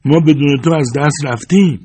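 loud, high pleading shout; high-pitched imploring cry; crowd crying out. A narrator's reading. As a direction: call out to him like a desperate crowd. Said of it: "مردم فریاد زدند".

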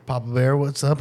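ordinary talk, nothing marked

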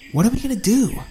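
high, panicky cry; panicked. Acted. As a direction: voice jumping up in panic. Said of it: "Allie asked in a panic".

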